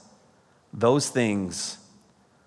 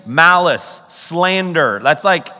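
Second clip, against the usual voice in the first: ordinary delivery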